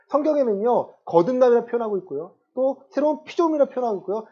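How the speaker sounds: loud, high-pitched sermon delivery; impassioned preaching. Real, not acted. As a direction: preach it forcefully, driving each point home. Preaches at full force.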